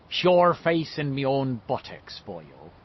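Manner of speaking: slow Irish brogue of a beat cop. A narrator's reading. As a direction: play a jolly Irish policeman. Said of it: "in the Irish cop's voice".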